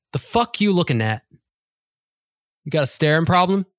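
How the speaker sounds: lightly voiced cat picking a fight; rude street cat.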